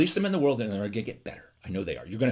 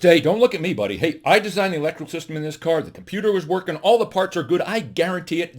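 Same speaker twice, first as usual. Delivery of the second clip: raised, higher-pitched defensive protest; defensive engineer; 6 semitones higher, 4 dB louder than usual. Acted. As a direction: insist that you are not to blame. Electrical engineer denies blame in joke.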